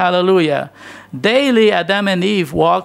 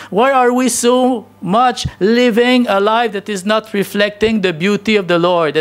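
building preacher questions; 4 semitones higher than usual. Real, not acted.

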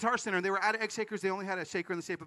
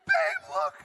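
much higher, loud and excited; freaking out; 5 dB louder than usual. Retold or imitated. Husband shows his wife the fortune, excited and much higher. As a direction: call to the wife in amazement. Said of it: "I'm freaking out and I'm like".

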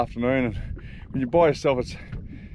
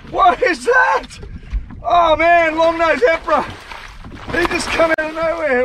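excited; 13 semitones higher, 5 dB louder than usual. Real, not acted. Surprise at emperor catch.

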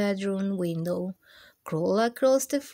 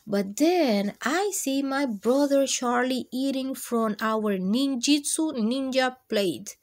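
plain reading voice

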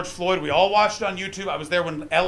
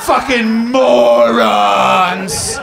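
mock shout; 4 semitones higher, 9 dB louder, much slower than usual. Mocking British view loudly.

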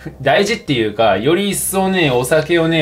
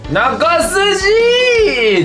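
excited shout; 4 dB louder than usual. Real, not acted. Shouting a mahjong call.

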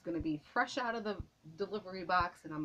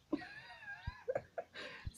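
a very high, soft squeal of a laugh; 9 dB quieter than usual. Real, not acted. Tickled by her tricky giveaway, squealing laugh. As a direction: let a tiny shriek of giggles escape.

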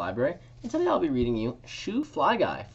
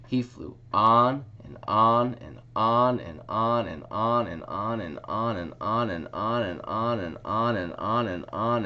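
lively picture-book story time voice; reading to children; 2 semitones higher, 5 dB louder, slower than usual. A narrator's reading. Librarian reading a picture book to children, lively. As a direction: read it aloud for little ones at story time.

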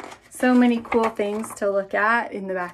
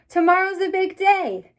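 higher calling voice for Maman Lucy; 9 semitones higher than usual. Acted. Maman Lucy calling Melba to eat, higher and calling. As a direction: call the child in to eat. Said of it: "calls Maman Lucy".

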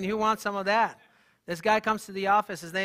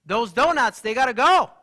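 stern lecture; 3 semitones higher, 7 dB louder than usual. Retold or imitated. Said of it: "I'm like".

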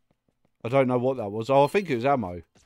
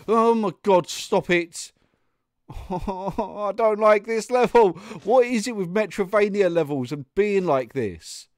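exasperated complaint; 6 semitones higher than usual. Real, not acted. Player fed up with a Metrovania level, real exasperated whine. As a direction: complain in a raised, pained pitch.

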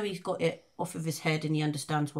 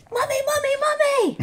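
put-on high, loud child's cry of mummy, much higher; son calling out; 9 dB louder than usual. Son calling his mum from his room, high and loud. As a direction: call for mum like a scared kid. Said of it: "I just hear him go".

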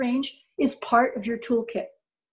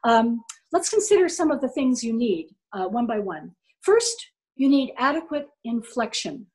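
ordinary delivery

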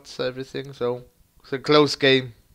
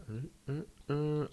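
ordinary talk

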